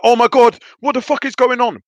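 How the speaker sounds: high, loud panicked cry; high frightened outburst. Retold or imitated. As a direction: panic at blacking out. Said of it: "I was like".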